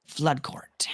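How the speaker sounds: throat failing, words barely voiced; hoarse whisper. Real, not acted. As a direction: voice giving out to a whisper. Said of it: "my throat's just giving out".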